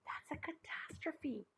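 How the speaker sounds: hushed, slow whisper of doom; mock-tragic whisper. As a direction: breathe it out as a tragedy. Child mourns toys.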